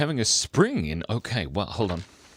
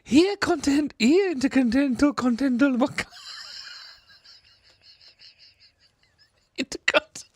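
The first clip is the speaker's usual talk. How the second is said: high, loud sing-song call; calling the kittens; 4 dB louder than usual. Pretend. Calling kittens by name outside, high sing-song call. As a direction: call the cats in from the yard. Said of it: "you can say".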